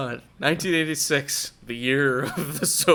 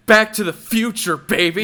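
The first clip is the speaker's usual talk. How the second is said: loud, high, hyped-up holler; excited shout; 6 semitones higher, 9 dB louder than usual. Real, not acted. Co-host celebrating an eighties theme, excited shout. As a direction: shout it like a rallying cry.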